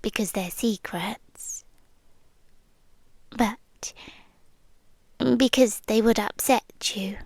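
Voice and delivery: hushed confiding whisper; whispered confession to the listener. Acted. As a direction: lean in and breathe it secretly. Confiding secret things.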